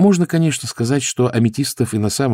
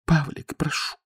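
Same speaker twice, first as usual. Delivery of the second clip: soft, higher-pitched entreaty; timid, hushed appeal; faint babble; 7 semitones higher, 6 dB quieter than usual. A narrator's reading. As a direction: plead feebly and very quietly. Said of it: "пролепетала она".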